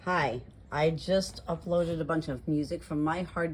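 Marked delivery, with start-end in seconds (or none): none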